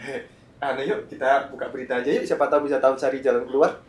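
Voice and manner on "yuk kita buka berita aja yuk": ordinary talk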